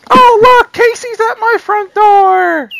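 squeaky high, slow excited voice; high silly voice. Pretend. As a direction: squeal it out like a cartoon character. Mock dog-owner squeal.

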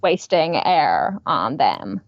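put-on slow, low Valley Girl drawl; mock-lazy creaky trail; vocal fry. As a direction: say it as if air is too much effort. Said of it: "vocal fry thing".

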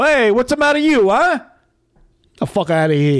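Italian mobster accent, hammed up. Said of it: "it's an Italian accent".

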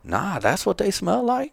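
high voice, rising at the end; amazed buddy, imitated. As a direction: imitate a naive friend learning something new. Imitating amazed city-kid buddy.

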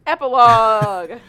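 loud, slow, stretched-out fanfare; drawn-out announcement. Real, not acted. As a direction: belt it out playfully, dragging the vowels. Announces the epilogue with playful fanfare.

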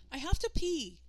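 put-on high, loud impatient girl; urgent girl. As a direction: push past him, desperate to pee. Sister urgently demands the bathroom.

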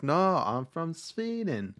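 high, slow sing-song Swedish put-on; mock Swedish accent. Actress correcting the man about being German, mock Swedish accent. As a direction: insist in an exaggerated Swedish accent, drawing it out. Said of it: "I guess I can do Swedish".

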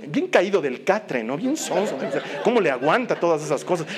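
ordinary delivery

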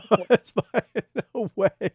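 high laughing reply. Real, not acted. Host laughing at the guest's dark joke.

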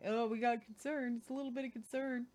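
sarcastic, mocking whine about concern. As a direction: sneer at their polite concern. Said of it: "Oh, I hate that".

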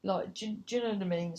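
usual speaking voice

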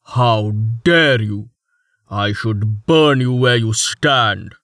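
loud, slow outraged noble; hammy stage actor. Acted. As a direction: threaten with pompous fury. Hakim's outraged threat.

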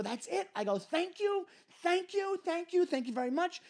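high, overly polite dismissal; forced thanks. Retold or imitated. Comic retelling dismissing the host with high fake thanks. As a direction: thank him to shut him up. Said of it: "Something in me just snapped".

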